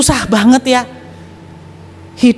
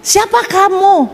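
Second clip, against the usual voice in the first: much higher, startled voice; startled asking; 11 semitones higher, slower than usual. Retold or imitated. Young man alone at night asks the voice, high and startled. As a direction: cry out who is there, startled. His frightened reply.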